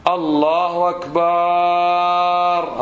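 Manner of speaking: الله أكبر chanted high with a long "baaar"; wrong muezzin-style stretch. Retold or imitated. Teacher imitating imams and muezzins, stretched akbaar. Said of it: "أو مؤذنين".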